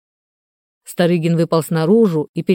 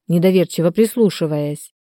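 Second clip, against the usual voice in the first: ordinary delivery, nothing marked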